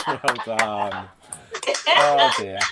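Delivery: usual speaking voice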